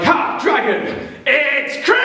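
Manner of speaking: hammed-up hero yelling the line; mock heroic shout. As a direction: bellow it like a gleeful warrior. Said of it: "it might go something like".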